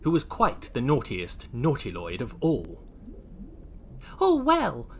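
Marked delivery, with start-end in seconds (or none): 4.2-4.9 high-pitched innocent little character; Oisin. Acted. Oisin answers the naughty Lloyds, in a high voice. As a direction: start high and fall away. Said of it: "said Oisin".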